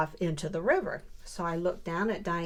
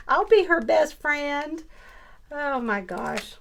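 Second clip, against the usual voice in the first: gleeful; 8 semitones higher, 7 dB louder than usual. Real, not acted. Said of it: "laughing, gleeful claim".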